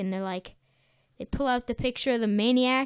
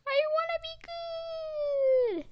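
much higher, slow, pleading I wanna be good; 6 dB quieter, slower than usual. Retold or imitated. Patrick pleading after SpongeBob scolds him, high and slow. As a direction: squeak it out like a sulky kid begging. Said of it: "Patrick is like".

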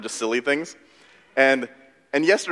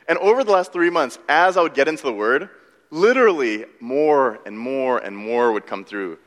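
usual speaking voice

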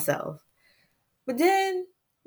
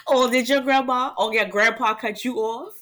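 giggling through the question; words bubbling with laughter; laughing. Real, not acted. Host asking listeners about grandparents cutting them off, laughing. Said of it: "I'm over here cracking up".

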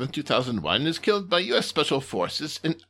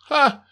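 loud high scoffing ha; scornful laugh; 10 semitones higher, 10 dB louder than usual. Acted. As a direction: bark a mocking ha. Boss scoffs.

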